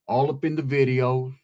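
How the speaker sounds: low-pitched mock quote; Suge Knight. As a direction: lightly imitate him, dropping the pitch. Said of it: "Suge Knight shit".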